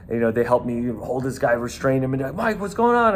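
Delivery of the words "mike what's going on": much higher, lightly voiced buddy; buddy asking. Security buddies arriving at the scuffle, high surprised voice. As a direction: ask it as a surprised coworker.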